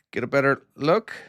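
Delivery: usual speaking voice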